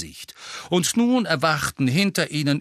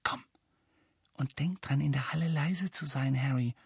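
hushed, much softer warning; whispering; 8 dB quieter than usual. A narrator's reading. Hermine cautioning Harry before the hall, whispered. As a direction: whisper so the hall won't hear. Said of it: "flüsterte Hermine".

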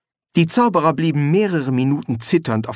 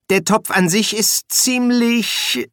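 higher, slow, careful explanation; hesitant bursar; 9 semitones higher, slower than usual. Acted. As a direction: start explaining slowly in a high voice. Bursar begins explaining the pot.

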